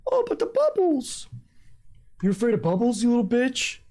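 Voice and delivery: high, mocking shout; Andrew Tate impression. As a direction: ham up the macho rant. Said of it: "his video where he talks about the bubbles".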